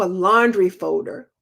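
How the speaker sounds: ordinary delivery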